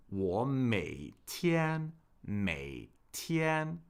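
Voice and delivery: slow tone demonstration. Shown as a demonstration. Teacher slowing down for learners to hear tones, 我每天 drawn out slowly. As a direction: stretch out each syllable of 每天 so the tones show. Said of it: "speak a little, uh, more slowly".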